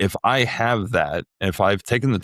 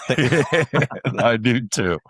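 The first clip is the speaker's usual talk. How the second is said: laughing; 4 semitones higher, much slower than usual. Real, not acted. Laughing after the mock ad.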